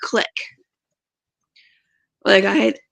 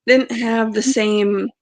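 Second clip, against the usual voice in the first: ordinary talk, nothing marked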